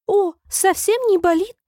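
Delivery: очень высокий голос, на грани визга; почти взвизгни от восторга; почти визг. A narrator's reading. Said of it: "почему-то едва не провизжала королева". (едва не визжа)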